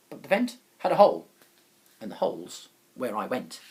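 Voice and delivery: ordinary delivery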